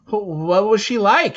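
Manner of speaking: high, loud, stammered eager ask; eager question. Acted. Boy begging the leader about his mother, eager question. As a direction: blurt it out, longing to know.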